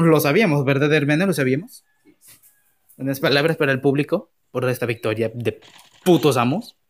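ordinary talk, nothing marked

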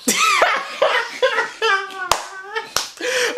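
long, high-pitched cackle; real laugh. Real, not acted. Big brother cracking up at his crying brother, cackling. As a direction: crack up helplessly.

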